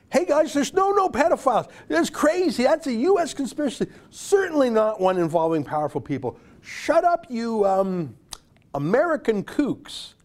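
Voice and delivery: high-pitched, hammed-up scoffing; mocking dismissal. Commentator parodies the CBC brushing off conspiracy talk, mocking and high-pitched. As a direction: mimic a dismissive broadcaster, pitched well up.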